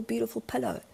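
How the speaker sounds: ordinary delivery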